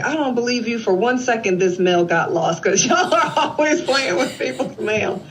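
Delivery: high-pitched scoffing retort, laughing through it; mock disbelief. Brother doubting the officer about lost mail, mock disbelief. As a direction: imitate an indignant brother, amused.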